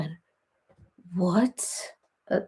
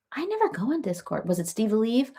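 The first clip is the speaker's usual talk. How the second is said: usual speaking voice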